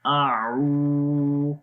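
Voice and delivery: very slow, chanted Quranic recitation. Shown as a demonstration. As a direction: draw each syllable out for students to repeat. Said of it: "اور لمبا بھی کریں گے".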